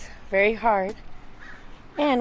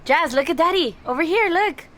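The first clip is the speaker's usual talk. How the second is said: calling from far away; 9 semitones higher, 6 dB louder than usual. Real, not acted. Father calling baby to look.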